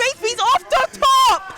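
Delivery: high, loud, slow hype yell; excited shout. Real, not acted. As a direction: scream the call. Reacting to the knockout.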